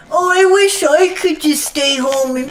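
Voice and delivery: mock the lazy losers in chat; mocking whine. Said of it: "all you losers are like".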